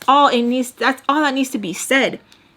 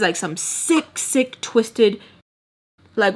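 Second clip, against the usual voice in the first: ordinary talk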